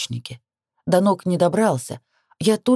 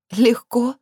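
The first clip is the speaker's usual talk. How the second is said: higher, mildly bitter echoed question; bitter scoff; 6 semitones higher than usual. A narrator's reading. Heroine throws her lover's word back, mildly bitter. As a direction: toss it back with a touch of bitterness. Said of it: "хмыкнула горько".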